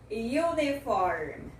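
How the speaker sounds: UNIFORM slowly, opening with 'you'; slow teaching demo of the Y glide in UNIFORM; Y-sound initial U. Shown as a demonstration. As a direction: stretch UNIFORM as 'yoo-ni-form'. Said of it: "esse U tem o som de U".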